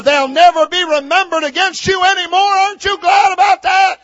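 loud, high-pitched revival preaching; full-force preaching. Real, not acted. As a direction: preach it out, building to an exultant shout.

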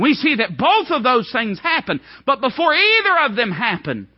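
high, loud preaching climax; preaching at full force. Acted. Preacher driving home John's despair, full-force preaching. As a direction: build and ring it out to the crowd.

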